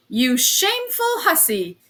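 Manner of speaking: shouting at the top of his voice; furious shout. A narrator's reading. Police officer shouting at a smiling woman, furious shout. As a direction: roar it out in outrage. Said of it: "he shouted suddenly at the top of his voice".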